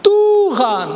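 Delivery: high, loud cry of Tuhan; shouting Lord. Acted. Stephen looking up, shouting to the Lord. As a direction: shout it up to heaven. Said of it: "dia berteriak".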